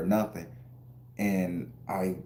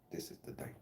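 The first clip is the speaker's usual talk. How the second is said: very soft, voiceless whisper; hushed whisper; 17 dB quieter, faster than usual. Real, not acted. Man subdued after telling his dream, real whisper. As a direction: let it drop to a bare whisper.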